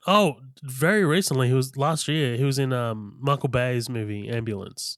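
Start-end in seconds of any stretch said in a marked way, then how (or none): none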